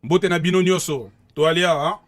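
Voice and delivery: put-on loud, proud Congolese-accented shout-out; Congolese greeting. Greeting his home country on air, loud Congolese accent. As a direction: hype up the folks back in Congo, Congolese accent. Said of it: "Ça, c'est le vrai accent".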